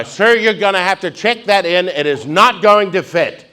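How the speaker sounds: high, loud stewardess order; stern stewardess. Retold or imitated. Stewardess repeats her order.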